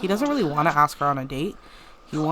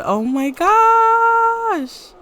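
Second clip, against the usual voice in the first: shocked squeal; 9 dB louder, much slower than usual. Real, not acted. Reacting to Sim jumping in.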